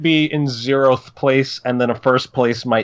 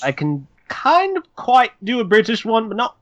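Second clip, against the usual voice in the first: British accent attempt; 7 semitones higher than usual. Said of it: "let's hear it".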